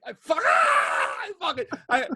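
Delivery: high-pitched excited outburst, words tumbling; overexcited yelling. Real, not acted. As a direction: yell it out, too thrilled to finish. Overexcited retelling of best moment.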